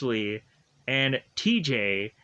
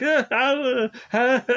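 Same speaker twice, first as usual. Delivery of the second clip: nervous stammer; 8 semitones higher, 4 dB louder than usual. Retold or imitated. Justin stammers, caught by rangers.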